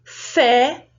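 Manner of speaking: "Fé" with a wide open eh; held, open-mouthed "Fé"; open vowel. Shown as a demonstration. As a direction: let the é in "Fé" open out. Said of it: "abre a saída do som".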